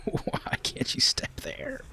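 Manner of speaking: slow, defeated whisper. Real, not acted. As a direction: breathe it out, worn down. Repeat after outburst.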